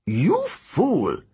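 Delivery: high-pitched, scornful demon; muttering demon. Acted. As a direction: scold a foolish fellow creature. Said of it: "the other Telekine muttered".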